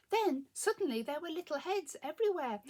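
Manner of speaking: ordinary delivery, nothing marked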